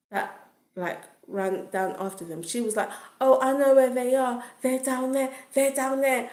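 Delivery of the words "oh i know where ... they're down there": high, louder, excited egging-on; high-pitched mock hype; imitating hyping up. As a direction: mimic someone eagerly urging on a chase. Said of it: "Like, hyping up the girl".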